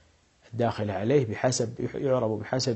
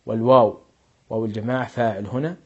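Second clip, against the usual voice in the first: ordinary talk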